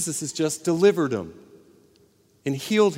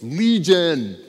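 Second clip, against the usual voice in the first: demon voice; 2 semitones higher, 5 dB louder than usual. Retold or imitated. Demoniac answers Jesus.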